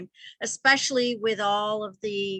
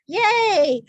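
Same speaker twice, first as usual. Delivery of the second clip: high, loud, drawn-out cheer; playful cheer; 10 semitones higher, 8 dB louder than usual. Real, not acted. Teacher cheering her group on before the exercise, playful cheer. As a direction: give a happy little whoop, higher and louder.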